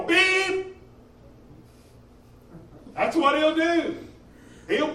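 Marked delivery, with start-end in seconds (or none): 0.0-0.8 imitated beep. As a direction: sound a beep like a warning buzzer. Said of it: "he'll go".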